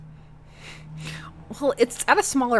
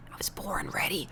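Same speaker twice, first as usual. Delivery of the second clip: whispered, trying to sound cool; whispered cocky line to Matt; 2 semitones lower, 10 dB quieter than usual. Acted. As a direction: breathe it out like a bad action hero. Awkward cool reply.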